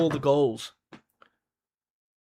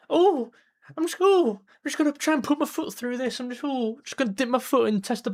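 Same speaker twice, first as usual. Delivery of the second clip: mocking a timid defender; 6 semitones higher than usual. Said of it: "Lock your foot and clear".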